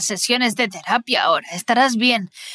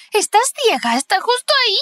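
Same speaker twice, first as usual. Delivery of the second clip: much higher, urgent and alarmed; panicked insistence; 10 semitones higher than usual. Acted. Alice pleading with Tara who sees nothing, panicked and high. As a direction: make her see it, voice pitched up in panic.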